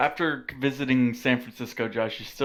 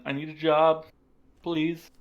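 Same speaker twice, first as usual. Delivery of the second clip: put-on unemployed accent; 4 semitones higher than usual. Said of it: "the unemployed accent".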